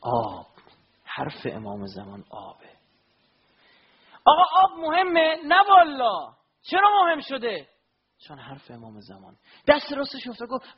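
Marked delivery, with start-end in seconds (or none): 4.2-7.7 higher, louder impassioned sermon; fervent preaching. Acted. Preacher stirring mourners with Abbas's story, fervent and loud. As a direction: preach it out with fire to the crowd.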